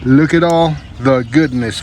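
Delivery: usual speaking voice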